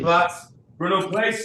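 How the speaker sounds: high, firm command to the dog; yelling across the room. Real, not acted. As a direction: call the dog back to its place. Ordering the dog to its place.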